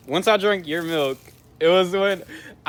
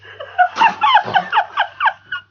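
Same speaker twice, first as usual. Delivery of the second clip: high shrieking laugh; 6 dB louder than usual. Real, not acted. Laughing through the pain.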